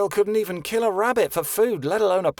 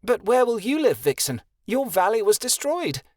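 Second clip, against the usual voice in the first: high, urgent protest; 10 semitones higher than usual. A narrator's reading. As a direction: object with worried urgency. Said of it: "Kia protested".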